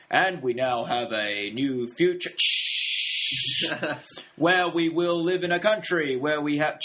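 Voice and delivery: mimicking a politician's grand speech, slowed down; Obama impression. Imitating Obama's speech for a film co-host, pompous. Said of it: "like, Obama speaking".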